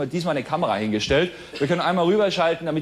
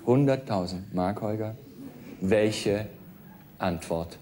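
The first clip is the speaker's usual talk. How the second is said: quiz show host; 6 semitones lower, 4 dB quieter, slower than usual. Acted. Quizmaster reads question.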